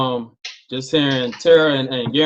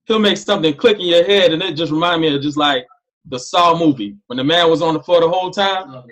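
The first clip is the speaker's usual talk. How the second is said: ordinary talk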